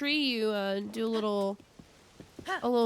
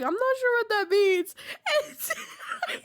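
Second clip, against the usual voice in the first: laughing; 12 semitones higher, 3 dB louder than usual. Retold or imitated. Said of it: "He's like".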